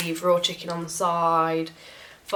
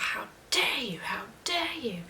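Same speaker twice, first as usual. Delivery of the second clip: high whispered shriek; mock scream; 7 semitones higher, 5 dB quieter than usual. Roommate screaming about the note, whispered mock scream. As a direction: imitate a screaming roommate without the volume. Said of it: "Screaming at me".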